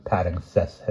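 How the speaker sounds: usual speaking voice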